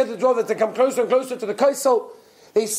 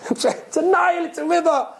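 incredulous scoff; 7 semitones higher than usual. Acted. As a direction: scoff at how absurd it is, voice lifted high. Ridicules crediting a river with feelings.

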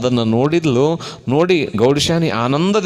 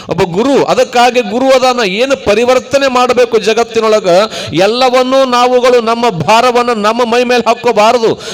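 higher, louder sermon voice; emphatic, raised preacher's delivery; forceful preaching; 7 semitones higher, 7 dB louder than usual. Real, not acted. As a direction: drive the moral home to a congregation.

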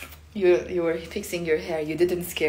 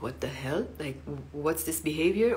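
softer, lower appalled aside; disbelief; 5 semitones lower, 8 dB quieter than usual. Shown as a demonstration. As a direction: ask what's going on in disbelief.

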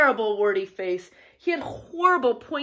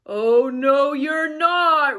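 big loud troll roar; 3 semitones higher, 8 dB louder, slower than usual. A narrator's reading. Troll forbids middle goat, roaring. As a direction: bellow the refusal, drawn out. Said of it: "roared the troll".

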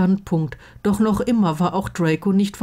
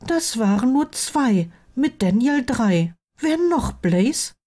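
higher-pitched prompting voice; probing best friend; 7 semitones higher than usual. Acted. Friend counting candidates and asking who else, probing. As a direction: press for the missing name.

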